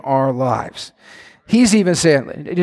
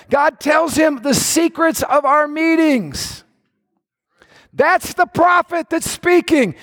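urgent advisor; 9 semitones higher, slower than usual. Retold or imitated. Advisor answers the king.

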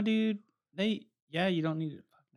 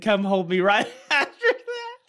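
laughing; 6 semitones higher, 7 dB louder, slower than usual. Real, not acted. Reading out a drunk friend's text.

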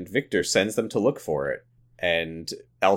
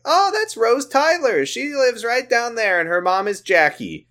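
put-on old neighbor lady; 13 semitones higher than usual. Neighbor lady telling Elton about Rose, high put-on voice. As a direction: chirp it like a helpful old neighbor. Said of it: "like, the old neighbor lady".

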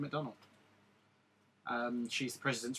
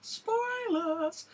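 sudden squeaky high voice; high put-on voice. Jokey put-on voice after spoiler.

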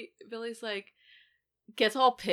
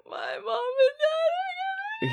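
very high, slow mock sob; mock crying Stu. Stu panicking about his parents, mock crying. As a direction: blubber like a scared kid. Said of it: "he's like".

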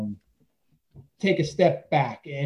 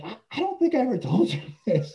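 laughing confession; 7 semitones higher than usual. Retold or imitated.

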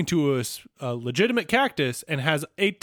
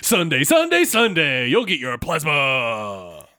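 monster truck ad; 3 semitones lower, slower than usual. Retold or imitated. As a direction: hype it like a truck rally commercial. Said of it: "monster truck rally voice".

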